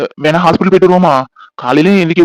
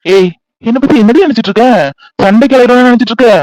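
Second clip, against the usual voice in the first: high, raised-voice retort; indignant protest; 6 semitones higher, 4 dB louder than usual. Acted. Husband accused of picking fights, indignant protest. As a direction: take offence and challenge her.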